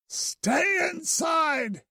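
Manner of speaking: high, slow desperate shout; shouting a warning from the fight; calling out. A narrator's reading. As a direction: yell for her to stay in. Calling out to Bloom in danger.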